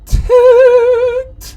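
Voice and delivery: very high, loud squeal; comically strained high pitch; mock annoyance. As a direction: squeeze the word out sky-high. Mock restraint bursts out.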